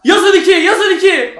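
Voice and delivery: loud, high repeated call; excited urging. Real, not acted. Fan demanding a second goal, excited shouting. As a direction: call for the goal with rising hope.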